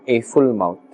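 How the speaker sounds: ordinary talk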